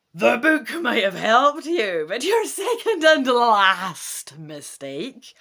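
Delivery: loud, slow dragon voice; dragon roar. Acted. Dragon closing in on the trapped human, loud menacing roar. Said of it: "the dragon roars".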